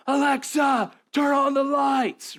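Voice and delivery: high, loud, slow shouted command; guy on the couch yelling for the lights. Retold or imitated. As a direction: bellow it from the other room. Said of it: "you hear this guy yell".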